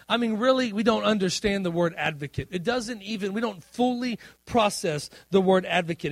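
normal talking voice

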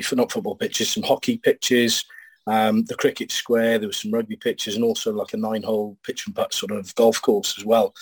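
ordinary talk, nothing marked

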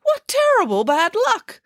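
very high, dismayed cry; theatrically appalled, pitch way up; aghast exclamation. A narrator's reading. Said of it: "exclaimed Nanny Piggins".

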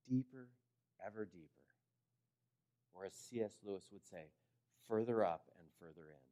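ordinary delivery

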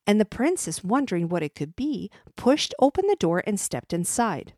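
ordinary delivery

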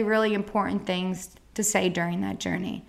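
ordinary delivery

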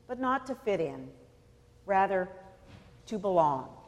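usual speaking voice

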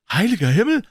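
high, loud exclamation; shocked cry. Acted. The old priest hears Holmes' name, high shocked cry. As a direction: blurt it out in alarm.

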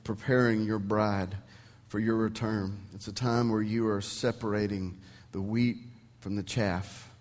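soft, hushed pastor's prayer. Acted. Pastor praying softly over the congregation, hushed prayer. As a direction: pray quietly before the sermon begins. Opening prayer before sermon.

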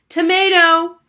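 loud, high shouted word; shouting. A narrator's reading. Harriet shouting her lunch order to the cook, loud. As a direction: yell it out. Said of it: "Harriet shouted".

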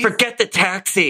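high, pushy mock yell; heated family shouting. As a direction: push her like a hammed-up bad boy. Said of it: "Stop yelling at me".

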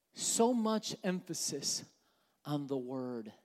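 "So much emphasis" slowed, "on the word" dropping low and soft; slow, hushed repeat, pausing before "on the word". Real, not acted. Preacher repeats phrase for weight.

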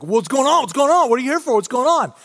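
panicked villagers pestering the prophet; freaking out. Retold or imitated. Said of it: "they are freaking out".

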